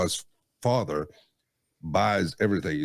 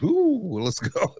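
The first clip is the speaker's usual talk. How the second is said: high ooh, eager; mock eager worker; 10 semitones higher, much slower than usual. Coworker mimicking an eager installer, high and excited. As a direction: squeal it like a keen worker.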